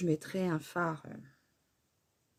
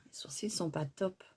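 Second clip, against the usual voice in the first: whispered confession, softer; whispered aside; 7 dB quieter than usual. Real, not acted. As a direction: let it slip out in a whisper. Embarrassed aside about her eyebrows.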